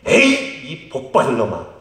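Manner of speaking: exasperated parent's cry, acted out; mock scolding shout. Shown as a demonstration. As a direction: shout out a playful scolding blessing. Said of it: "이렇게 소리치십시오".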